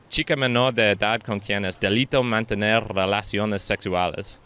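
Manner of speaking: Spanish in an off, English-sounding accent; odd-accented Spanish. Real, not acted. Reading out the Spanish term for confirmation, foreign-accented Spanish. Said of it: "sounded like with a random accent".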